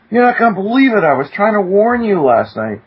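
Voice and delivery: high-pitched, eager rush of words; retold excitement. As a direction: neighbor bursting in with news. Neighbor rushes over excited.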